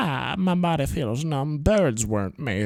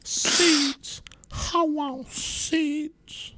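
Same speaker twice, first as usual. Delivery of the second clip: bird begging for seeds; 13 semitones higher, much slower than usual. Acted.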